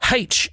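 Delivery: "H" said as HAITCH; letter "H" beginning like "hay"; haitch, imitated. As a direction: put an h sound on the front of "H". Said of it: "people that will say".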